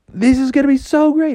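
put-on high, quick kid-frog delight; excited. Froggy thrilled about the golden goose, excited. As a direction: bubble over in a high voice.

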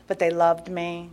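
ordinary talk

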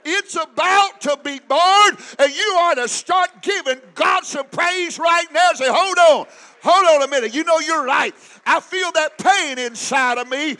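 loud, driving preacher's shout; shouting sermon climax; full-force preaching. Acted. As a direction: preach it out to the church at full volume.

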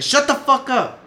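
very high, loud, slowed furious shout; retold scream. Son yelling at his dad against the wall, enraged scream. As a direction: shout each word hard at him. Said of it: "I told him like that".

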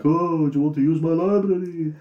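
a hint of a courtly host offering his library; Christopher Lee. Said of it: "Christopher Lee's like".

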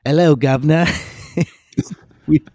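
a bad cockney-style hello, Governor; mock London accent. Podcast host greets co-host in a bad London accent. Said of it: "that's my best".

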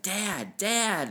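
imitated daughter's high-pitched call for Dad. Daughter running back outside to her father, calling out.

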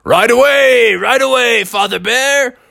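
high, loud storybook dragon; obliging dragon. Acted. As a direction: call it down eagerly from the air. Dragon answers from the sky.

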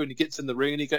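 ordinary talk, nothing marked

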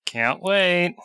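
words forced through clenched teeth; through gritted teeth. Real, not acted. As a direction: grit your teeth and say it. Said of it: "He said that through gritted teeth".

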